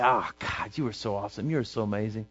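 quiet awestruck praise to God; soft adoring prayer. Pretend. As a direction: breathe it out like a grateful worshipper. Believer praising God aloud.

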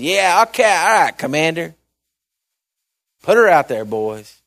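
grudging, sarcastic yes to the commander. Retold or imitated. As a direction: brush off the order with mock agreement. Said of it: "I think he said it sarcastically".

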